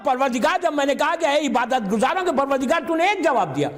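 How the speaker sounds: high-pitched, fervent sermon delivery; rising majlis preaching; impassioned preaching. Acted. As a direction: drive the point home to the crowd. Preaching at full force.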